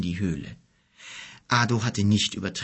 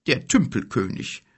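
higher-pitched, bitter self-naming; 4 semitones higher than usual. A narrator's reading. Old king names himself to the guest, bitterly. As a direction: add the title with a touch of bitterness. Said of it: "setzte dann bitter hinzu".

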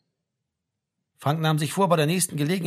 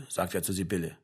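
plain reading voice

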